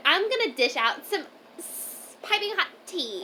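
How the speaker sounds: high sassy gossip voice; gossipy sass. Retold or imitated. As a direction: tease like a gossip host. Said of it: "And you're like".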